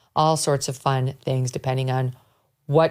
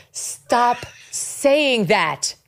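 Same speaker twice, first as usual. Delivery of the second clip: exasperated; 7 semitones higher, much slower than usual. Retold or imitated. Host repeating advice, exasperated.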